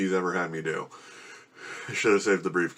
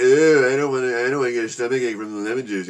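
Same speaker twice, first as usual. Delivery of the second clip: high, louder mocking voice of a friend on the first words, then quicker; mocking whiny imitation; 3 semitones higher, 4 dB louder than usual. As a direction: mimic him whining like a picky kid. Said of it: "making fun of me".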